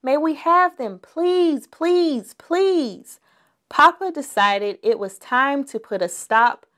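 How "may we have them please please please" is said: high, insistent pleading cubs; begging cubs. A narrator's reading. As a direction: keep begging, please after please. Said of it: "they shouted".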